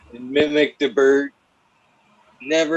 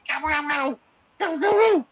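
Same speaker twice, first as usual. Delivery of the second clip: high squeaky taunt at the turkeys; squawky turkey-baiting taunt; mock taunting high voice; 14 semitones higher than usual. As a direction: egg the birds on in a shrill taunt. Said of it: "I'll be like".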